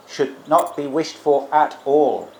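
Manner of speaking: slow, weighted NOT and AT ALL; emphatic. Shown as a demonstration. Teacher showing how the translation should sound, slow and emphatic. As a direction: stretch "at all" into a firm, separate emphasis. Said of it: "Alang is like an emphatic particle".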